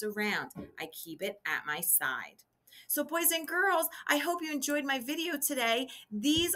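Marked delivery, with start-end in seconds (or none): 0.8-2.4 softer, lower repeat; soft reminder. Shown as a demonstration. Teacher repeats the lunchbox rule to kids, softly. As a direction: repeat it gently and quietly. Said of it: "I don't want to hurt anyone".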